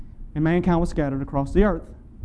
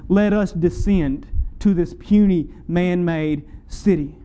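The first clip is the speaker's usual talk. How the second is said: ordinary talk, nothing marked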